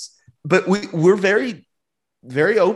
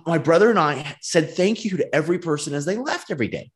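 normal talking voice

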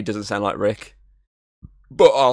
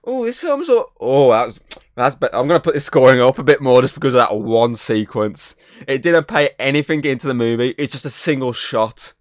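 lightly acted gushing critic, higher and louder; 3 semitones higher, 6 dB louder than usual. Podcast host mocking critics swayed by one scene, gushing. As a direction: play an easily impressed critic getting carried away. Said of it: "influence critics and go".

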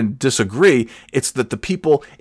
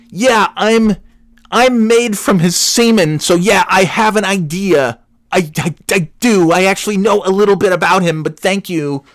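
high, emphatic sarcastic comeback; exasperated retort; 8 semitones higher, 3 dB louder than usual. Retold or imitated. Son answering a yelling church woman, exasperated. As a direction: push back, raised voice in acted exasperation.

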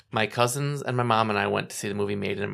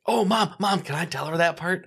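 high, louder eager plea, retold; excited child; 8 semitones higher, 3 dB louder than usual. Child begging Mom to let her tell Grandma, excited. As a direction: imitate a kid bursting to tell. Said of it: "I'm all excited".

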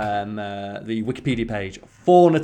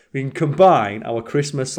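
ordinary delivery

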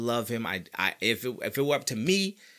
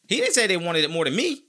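ordinary talk

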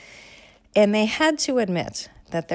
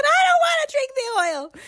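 put-on high, whining kid voice; high whiny refusal; 5 dB louder than usual. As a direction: pitch it way up like a pouty child. Child voiced in story.

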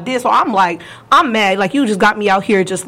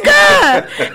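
loud, high-pitched mimicked holler; imitated woman's yell; 4 dB louder than usual. Showing how the ex called his name, high holler. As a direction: call him out at the top of the voice. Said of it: "This is her saying his name".

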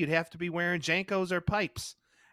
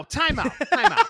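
higher and louder, shouting time out; calling time out; 11 semitones higher, 10 dB louder than usual. Real, not acted. Podcast host stops his co-host mid-topic, loud call. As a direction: cut in with a loud, high call.

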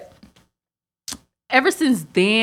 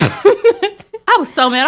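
mock outrage; 10 semitones higher, 5 dB louder, much slower than usual. Real, not acted. Exaggerated retelling.

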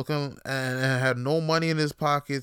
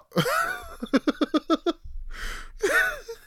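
high shrieking laugh. Real, not acted. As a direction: burst into a high squealing laugh. Laughing hard at own story.

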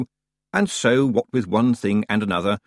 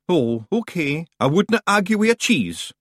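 high, loud Scottish pixie; Scottish accent; 9 semitones higher, 3 dB louder than usual. Acted. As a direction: shout it like a wee Scottish warrior. Rob shrugs it off.

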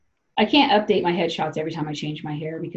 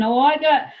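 excited betting; 9 semitones higher, 4 dB louder than usual. Retold or imitated. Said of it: "the other dudes weigh in".

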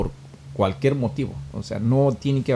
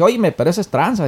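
raised, hurried mock protest; higher, louder acted-out objection; retold indignant accusation; 8 semitones higher, 6 dB louder, faster than usual. Lawyer accuses the millionaire of cheating, retold indignantly.

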